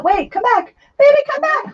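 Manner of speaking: rising high, playful plea; calling after it. Real, not acted. Streamer calling after pens rolling away, high and pleading. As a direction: call the runaway pens back, pitch climbing.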